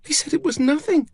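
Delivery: put-on high, trembling child's voice; very high, tremulous and upset. A narrator's reading. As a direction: say it as a shaken little girl. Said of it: "her voice trembling".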